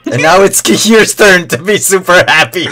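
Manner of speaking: can't stop giggling while talking; cracking up mid-sentence; laughing. Real, not acted.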